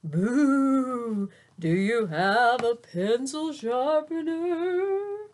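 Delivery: slow, sung, spooky ghost voice. Acted. Ghost pops into class asking for a sharpener, sung and spooky. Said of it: "a real ghost, appeared and said".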